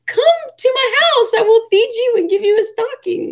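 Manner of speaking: high, sweet cooing invitation; sing-song invite to lonely strangers. Retold or imitated. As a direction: coo it like a doting mom. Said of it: "I'm like".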